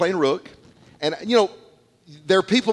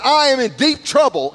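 desperate cry; 10 semitones higher, 6 dB louder than usual. Retold or imitated. Isaiah's cry retold.

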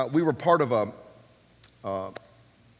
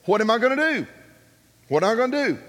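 mock desperation; 6 semitones higher, 4 dB louder, faster than usual. Retelling his dilemma without money.

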